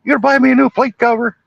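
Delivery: high, fast mock yell; hammed-up high villain yell. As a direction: squeal it like a crazed killer chasing the girl. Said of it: "he's yelling at his brother".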